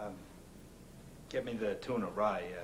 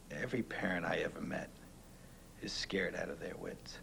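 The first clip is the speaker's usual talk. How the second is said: soft reassuring; 7 semitones lower, 5 dB quieter than usual. Acted. Quiet encouraging talk to friend.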